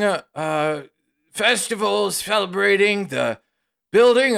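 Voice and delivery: high, folksy host covering up a secret; nervous villager. Acted. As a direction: bluff innocence, stammering, in a high rustic voice. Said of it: "another voice goes".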